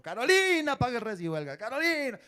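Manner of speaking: reclamo agudo, fuerte en el nombre; llamado a gritos. Acted. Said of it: "vino aquí a gritarme". (grito de cobro, imitado)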